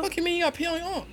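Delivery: high, fast disbelieving retort; retold high-pitched disbelief; incredulous. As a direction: act out a baffled comeback, voice shooting way up. Retells baffled reply to friend.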